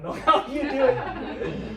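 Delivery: laughing. Real, not acted. Laughing aside about auctioneers.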